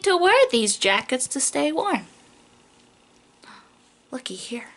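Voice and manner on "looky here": whisper. Acted. Reading alphabet book to child.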